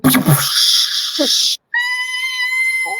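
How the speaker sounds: shrill high cackle; a manic clown laugh. Acted. Clown's wild laugh in scene.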